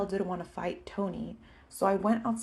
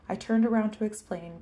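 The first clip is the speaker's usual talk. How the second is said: ordinary delivery, nothing marked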